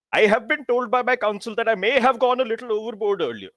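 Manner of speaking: high-pitched, self-important hero voice; pompous concession. Acted. Hero leaning in to admit going overboard to juniors, pompously. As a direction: deliver an official admission like a little monarch.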